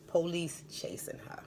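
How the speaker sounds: hushed dramatic whisper. Acted. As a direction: whisper it like a secret. Dramatic retelling of the chase.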